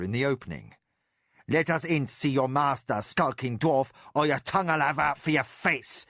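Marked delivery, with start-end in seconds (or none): 1.5-6.1 a hint of gutter thug menace; lightly voiced threatening thief; menacing thug. A narrator's reading. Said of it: "he said in a gutter Surani dialect".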